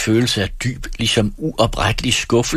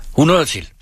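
quick eager bid; 8 semitones higher, 4 dB louder than usual. A narrator's reading. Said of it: "bød som et lyn".

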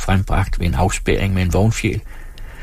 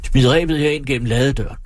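higher-pitched mild shout, no added volume; calling out; 5 semitones higher than usual. A narrator's reading. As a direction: call urgently towards the window. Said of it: "råbte mod vinduet". (behersket råb mod vinduet)

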